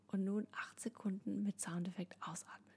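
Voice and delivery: soft whisper while exhaling; whispered instruction. Shown as a demonstration. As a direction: whisper it on the out-breath. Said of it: "ich mach das einmal vor".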